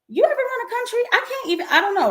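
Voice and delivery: high, incredulous pitch jump; exasperated disbelief. Real, not acted. To critics of the president, high exasperated disbelief.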